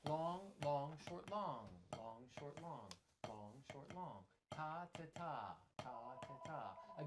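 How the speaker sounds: chanted "long" held, "short" brief, then "ta"; long-short rhythm. Shown as a demonstration. Reciting the full Spang-Lang pattern, rhythmic long-short chant. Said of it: "full Spang-Lang pattern".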